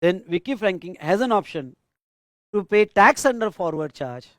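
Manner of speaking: very slow, each phrase said twice for writing down; slow dictation. Acted. Teacher dictating the case answer to students, slow and repeated. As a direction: dictate it phrase by phrase so the class keeps up.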